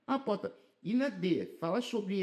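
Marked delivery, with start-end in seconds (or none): none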